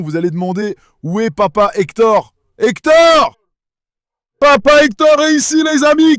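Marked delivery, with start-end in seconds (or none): 2.6-6.2 calling the lion, pitched higher and louder. Real, not acted. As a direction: sing out his name to the lion. (appelle son lion Hector d'une voix aiguë)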